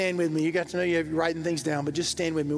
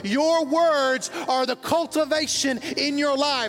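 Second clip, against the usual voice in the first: preaching at full force; 6 semitones higher than usual. Acted. Pastor preaching to the congregation about words, full-force fervour. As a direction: lift the church with rising fervour.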